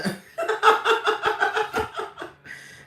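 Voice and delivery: high airy laugh; real giggle after the joke; breathy giggle. Real, not acted. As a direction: crack up at yourself. Laughs at own bit.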